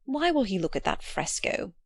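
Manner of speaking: ordinary delivery, nothing marked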